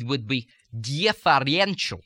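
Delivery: differential in a Russian accent, every syllable kept fairly strong; Russian accent, no syllable in differential left unstressed. Shown as a demonstration. Teacher contrasting Russian stress on differential with American. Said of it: "But in the Russian accent".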